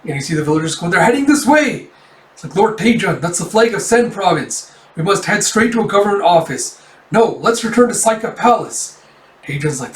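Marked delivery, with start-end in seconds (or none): 0.9-1.9 high, loud villager's alarm; raised-pitch panicky shout, acted; alarmed warning. Villagers warn of approaching army. 2.5-9.0 higher-pitched, worried urging; mildly panicked retainer, acted; urgent alarm. Villager urges lord to retreat.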